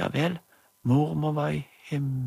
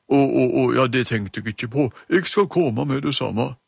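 louder, gruff hallo; gruff doctor voice; 5 dB louder than usual. Acted. Emma as doctor answers phone, gruffly. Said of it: "hun gjorde seg veldig grov i målet".

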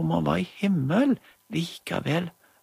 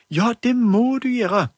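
much higher, louder pleading mum; urgent mother; 9 semitones higher, 6 dB louder than usual. Acted. Mother begs doctor to come, high and urgent. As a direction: urge the doctor to hurry. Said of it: "Det var Julie som snakket for mammen".

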